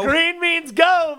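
slow, high and loud; emphatic punchline delivery. Real, not acted.

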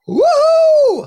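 loud, very high whoop; celebratory whoop. Retold or imitated. Couple seeing their lottery numbers win, whooping. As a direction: whoop like a big winner. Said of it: "So they're like".

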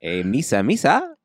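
goofy creature catchphrase, leaping up in pitch; Jar Jar Binks. Retold or imitated. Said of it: "Immediately thought Jar Jar Binks".

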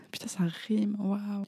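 amused breathy whisper. Real, not acted. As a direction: whisper it with a laugh. Amused aside after a rhyme.

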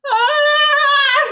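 a high, sustained rock-star cry; sung rock wail. Acted. The band taking the stage, belting a rock wail. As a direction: belt it like the band's opening note.

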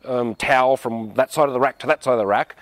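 lightly voiced fussy overreaction; a hint of a petty, reactive complainer; mock overreactive. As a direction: mimic a touchy person making a fuss. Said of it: "who are really reactive and go".

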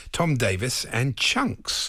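slow, deliberate naming; slow and drawn out. Shown as a demonstration. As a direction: drag out each name. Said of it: "You make it sound like a vent act".

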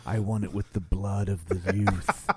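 low, hammed-up villain voice; sinister villain demand; evil voice. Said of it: "evil voice".

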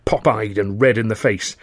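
ordinary delivery, nothing marked

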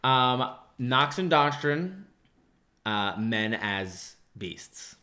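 very slow, weighty announcement with long pauses; solemn presentation. Real, not acted. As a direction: present it like a grand thesis, word by word. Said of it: "the way you're treating it".

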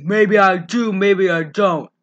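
loud tough-guy impersonation; hammed-up, loud movie hero; Sylvester Stallone. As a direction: put on an action-star voice. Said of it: "totally sounded like Sylvester Stallone".

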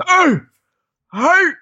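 loud, high-pitched imitated yelps; doppelganger's calls. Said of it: "it's a good impression".

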